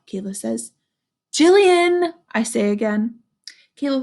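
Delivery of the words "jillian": insistent dog. Acted.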